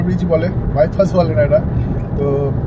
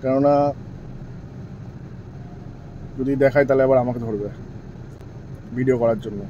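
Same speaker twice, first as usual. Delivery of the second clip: speaking softly; 4 semitones lower, 7 dB quieter than usual. Real, not acted. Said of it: "লুকিয়ে লুকিয়ে দেখাচ্ছি".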